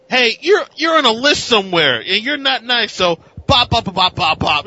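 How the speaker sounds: rough up the crook, playfully; mock tough guy. Bucky roughing up a crook, in a high hammed-up bully voice. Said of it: "he's just like".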